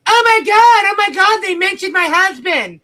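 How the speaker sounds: high, loud mocking panic; mocking whine. YouTuber mocking a rival's panic over her husband, shrill mock whine. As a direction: squeal it like a dramatic victim. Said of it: "to go".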